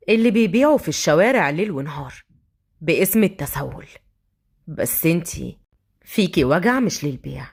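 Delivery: low-pitched, measured man's voice; brooding street thug. Acted. Thug reading the tissue seller, low brooding voice.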